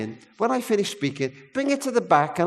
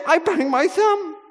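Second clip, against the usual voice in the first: put-on high, slow little boy reporting his sore thumb; a small boy trying not to cry; hurt child's voice; 11 semitones higher, slower than usual. Hurt boy tells the operator about his thumb, high and slow.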